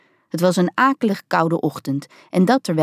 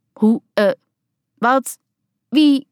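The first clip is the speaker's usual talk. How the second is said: halting, rising broken words; a startled woman stammering at a stranger; stammering, confused; 6 semitones higher, 4 dB louder, slower than usual. A narrator's reading. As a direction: caught off guard and flustered. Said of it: "stamelde ze verward".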